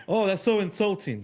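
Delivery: mock offended. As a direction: imitate someone making a show of offense. Mocking Democrats' offended reaction.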